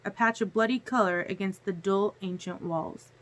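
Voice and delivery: ordinary delivery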